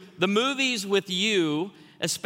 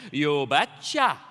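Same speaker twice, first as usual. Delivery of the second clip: put-on slow, drawn-out Norwegian accent; imitating a Norwegian father-in-law; thick Norwegian accent; 4 dB louder, much slower than usual. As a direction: stretch both words in a thick Norwegian accent. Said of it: "responded in his thick Norwegian accent".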